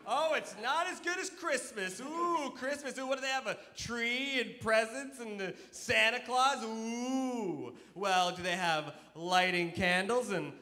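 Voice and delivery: high, slow sing-song mimicry; hammed-up pouting sing-song; mock-sulking dad. Dad mimics son, then asks.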